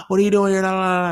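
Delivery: gasping, high and loud "What are you doing here?"; a startled gasp, then delighted surprise; surprised gasp. Retold or imitated. Girlfriend shocked to find him at her door, gasping surprise.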